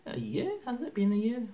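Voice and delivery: year shooting up high in surprise; a real surprised squeak: a year?; disbelieving rise. Real, not acted. Surprised at how long braces were.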